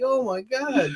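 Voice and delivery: high, stunned gasp of oh my God; imitating stunned disbelief; mock shock. Retelling own reaction.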